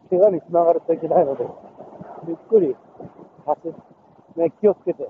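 straining for air while running; pushing words out between breaths. Real, not acted. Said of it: "このハーハーいう息の音が".

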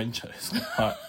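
ordinary talk, nothing marked

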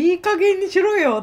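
high, loud scolding mother; angry mother. Retold or imitated. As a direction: snap like a fed-up mother. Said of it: "って言ってた、お母さん".

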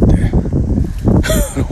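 high, airless squeal of a laugh. Real, not acted. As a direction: burst out in a thin high laugh. Laughs at his own story. (高く息だけの笑い声)

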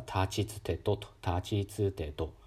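たちつてと slow, every vowel full; clear slow tachitsuteto. Shown as a demonstration. Teacher modelling a careful, slow たちつてと with full vowels. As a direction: spell out たちつてと carefully, slowly. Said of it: "しっかり母音を発音".

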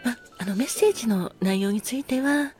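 ordinary delivery

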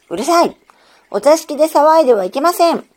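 high, loud scolding shout from a stern aunt; scary aunt scolding. A narrator's reading. Aunt yells at the girl for playing in the parlor, loud stern scolding. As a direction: bark at the children like a fearsome aunt. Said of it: "怖いおばさまの声でした".

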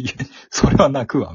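高く大きな声で同情のツッコミ; 観客の気持ちを大げさに代弁; おどけたツッコミ. Retold or imitated. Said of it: "みたいな". (高めの大声でおどけて言う)

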